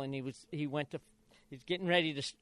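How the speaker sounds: normal talking voice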